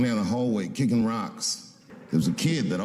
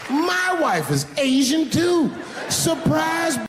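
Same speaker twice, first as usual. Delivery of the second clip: high, drawn-out gleeful announcement; triumphant reveal; 14 semitones higher, slower than usual. Acted. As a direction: crow the surprise to the crowd. Triumphant punchline reveal to the woman.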